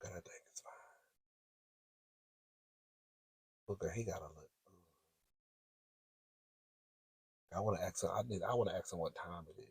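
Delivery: very soft, low murmur; barely audible, almost a whisper; hushed aside. Real, not acted. Confiding to a girlfriend as he walks again, hushed aside. Man walking past her again.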